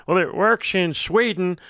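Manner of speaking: mocking quote. Mocking an opponent's argument.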